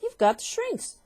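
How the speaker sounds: very high, loud accusing cry. Acted. Husband pointing a finger at his wife, crying out high. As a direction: fire it at her like a shot. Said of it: "cried Mister Twit".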